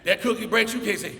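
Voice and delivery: normal talking voice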